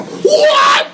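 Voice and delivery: mock shouted what; yelled what. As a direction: yell it to shut him down. Umpire yelling at mumbling coach.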